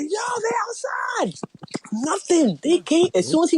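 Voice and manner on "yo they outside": much higher, slow, called out in mock alarm; mock alarmed shout. Retelling the kid in the show, a mock alarmed shout. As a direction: yell the warning like a lookout. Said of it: "He was like".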